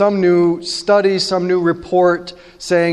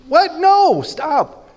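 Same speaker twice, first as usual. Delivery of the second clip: sharp, much higher cry of disbelief; startled what; 11 semitones higher, much slower than usual. Real, not acted. Preacher recoiling at the firstborn offer, high surprised cry. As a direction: jump up high, stop them short.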